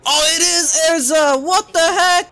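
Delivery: high, loud startled outburst; shocked yelp; surprised. Real, not acted. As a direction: caught off guard, exclaim it. Recognises Erza as villain.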